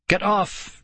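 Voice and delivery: high, lightly voiced shriek; a hint of a panicked shriek; mock shriek. A narrator's reading. Girl tangled in the pit, light mock shriek. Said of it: "Nicole shrieked".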